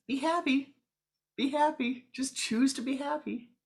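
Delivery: high, loud, joyful repetition; the yogi calling out mid-lesson; exuberant teaching. Acted. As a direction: proclaim it with beaming delight. Yogi says it again next week.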